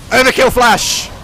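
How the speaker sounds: very loud, high, drawn-out yell; triumphant slow battle cry; hype shout. Real, not acted. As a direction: call the play like a highlight. Yells the big play.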